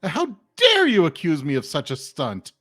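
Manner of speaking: mildly affronted exclamation, punching "dare"; taken aback. Acted. Contestant accused of cheating, taken-aback protest. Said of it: "look very taken aback".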